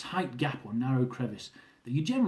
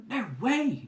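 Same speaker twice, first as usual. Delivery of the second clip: higher, surprised no way; exclaimed; 10 semitones higher than usual. Retold or imitated. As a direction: gasp it out in disbelief. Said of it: "Jill exclaimed".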